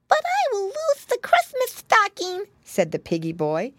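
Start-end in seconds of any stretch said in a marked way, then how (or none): 0.1-2.5 very high little pig whine; high childlike protest; protesting piglet. A narrator's reading. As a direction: object like a small piggy boy. Piglet objects to the plan.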